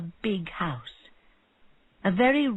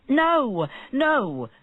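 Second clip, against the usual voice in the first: urgent protest; 7 semitones higher, 5 dB louder than usual. A narrator's reading. Fish seller protesting.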